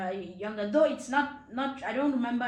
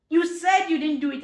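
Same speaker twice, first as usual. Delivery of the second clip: loud, higher accusing scold; angry mom; 6 semitones higher, 10 dB louder than usual. Retold or imitated. Mom accusing her daughter of lying, loud and cross. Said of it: "She'll be like".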